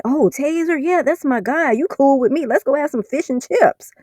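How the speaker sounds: high-pitched goofy mock gangster; high sarcastic impression of a gunman turned best friend; mock friendly gunman. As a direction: play the gunman as a cartoonish buddy. Imagined gunman welcoming reply, mocked.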